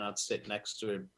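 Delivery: ordinary talk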